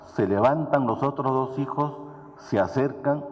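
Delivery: ordinary talk, nothing marked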